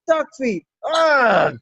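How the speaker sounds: ordinary talk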